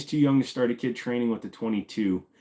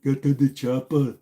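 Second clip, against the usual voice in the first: a second, lighter Austrian accent try; Arnold again; 4 semitones higher than usual. Shown as a demonstration. Retrying the Arnold Austrian accent for chat, lighter. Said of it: "Arnold Schwarzenegger impression".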